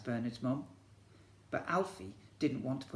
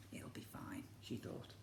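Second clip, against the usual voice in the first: hushed whisper; whispered thought; 14 dB quieter than usual. Acted. Mum thinks to herself, whispered. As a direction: breathe it as a private thought.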